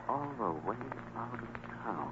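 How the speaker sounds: soft, dropping repeat to himself; quiet musing. Acted. Father muses to himself, softly repeating the words.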